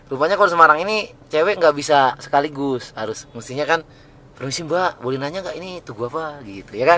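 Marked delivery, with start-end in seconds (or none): 4.3-6.4 soft, tentative polite question; polite, indirect approach. Shown as a demonstration. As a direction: ease into asking a stranger politely. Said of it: "Jangan langsung".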